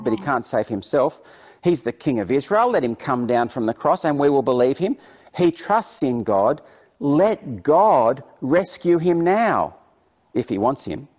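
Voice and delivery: loud, emphatic read-out jeer; lightly acted public mockery; mocking. A narrator's reading. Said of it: "and the elders mocked him".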